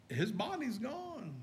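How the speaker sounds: soft, slow, awed murmur; hushed stunned words. Acted. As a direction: let it fall away in shock. Stunned disciples, imagined words.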